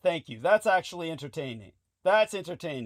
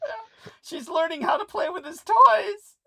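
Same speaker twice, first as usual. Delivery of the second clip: laugh-crying; 6 dB louder than usual. Pretend. Laughing high mock.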